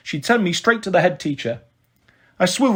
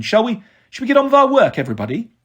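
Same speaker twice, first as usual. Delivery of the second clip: brisk teacher; 5 semitones higher, faster than usual. A narrator's reading. Teacher restoring class order.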